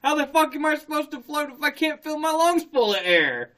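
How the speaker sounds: high-pitched, indignant retold protest; exasperated mock panic. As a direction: demand an answer in comic panic. Retold panic while choking underwater.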